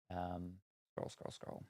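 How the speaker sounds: whispered scroll scroll; whispering. Real, not acted. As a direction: hush to himself while scrolling. Scrolling chat softly.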